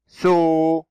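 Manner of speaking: "سو" as a long, held "soo"; long vowel. Shown as a demonstration. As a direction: stretch the oo in "سو", pitched steady. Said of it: "السين صوت طويل". («سو» بصوت طويل ممدود)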